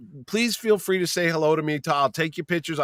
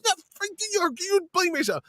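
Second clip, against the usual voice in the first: high, squealed comic retort; indignant friend. Retold or imitated. Friend refuses blame for the ice cream, high indignant voice. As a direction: throw the blame back in a high mock voice.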